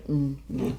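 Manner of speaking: low, soft dog voice; dog refusing. Pretend. Dog refusing a kiss, low and grudging.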